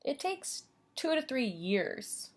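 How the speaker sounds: put-on lively storybook reading for little kids; bright teacher storytime voice; reading to children. A narrator's reading. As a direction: read it like storytime for preschoolers. Teacher reads picture book to class.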